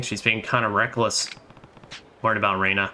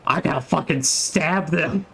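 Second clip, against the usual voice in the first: slow, higher, hammed-up jealous menace; mock Majima; 6 semitones higher than usual. Streamer imitating Majima threatening Kiryu's dates, slow mock menace. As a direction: drag it out like a possessive lunatic. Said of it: "Majima's like".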